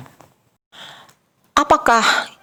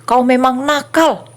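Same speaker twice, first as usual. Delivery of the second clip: judging; 5 semitones higher than usual. Acted. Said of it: "Atau menghakimi".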